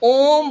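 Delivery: ओम stretched out long and level; extra-long vowel. Shown as a demonstration. Teacher asking how long it takes, ओम drawn out. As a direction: hold the ओ of ओम well beyond normal. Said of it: "तिगुना, दुगुना समय लगा".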